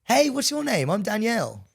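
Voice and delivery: put-on high, bright girl voice; chirpy woman character voice; girly high voice. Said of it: "And I'd be like".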